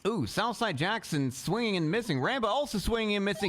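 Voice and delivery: live ringside commentary, running call of every punch; fight play-by-play. Acted. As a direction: call the fight blow by blow like a sportscaster. Live play-by-play of round two.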